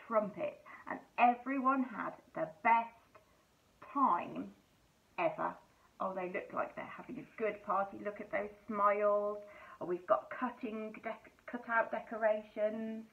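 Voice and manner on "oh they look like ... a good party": plain reading voice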